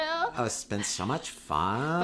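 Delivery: slow, drawn-out California uptalk; mock California accent. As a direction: stretch it out in parody Californian. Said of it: "we learned how to talk like this".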